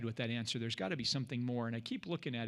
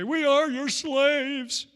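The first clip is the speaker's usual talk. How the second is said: groveling brothers; 11 semitones higher, 8 dB louder, much slower than usual. Retold or imitated. Brothers bowing before Joseph.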